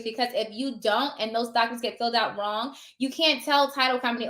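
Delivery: ordinary talk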